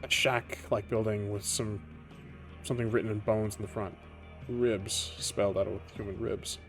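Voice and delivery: ordinary delivery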